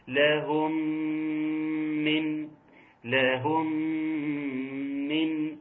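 لهم من with the meems merged and humming; nasalized merging. Shown as a demonstration. Teacher shows the idgham with ghunna on لهم من. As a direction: merge the meem of لهم into من with a two-beat hum. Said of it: "يعني اقراها ازاي".

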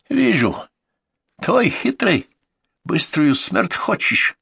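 put-on high, sneering highlander accent, slow at the end; mocking Caucasian accent. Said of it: "снова зверато усмехнулся".

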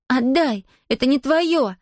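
put-on high-pitched girl protesting; sharp childish protest; indignant demand. A narrator's reading. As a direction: demand it back, upset and high. Girl demands her device back.

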